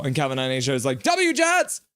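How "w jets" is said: high, punchy shout; excited fan cheer. Acted. Streamer voicing a Jets fan in chat, high cheer.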